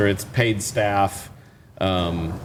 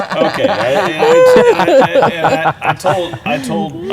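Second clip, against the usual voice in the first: talking through laughter; words broken by a laugh; 10 semitones higher, 9 dB louder, slower than usual. Real, not acted. Laughing fit.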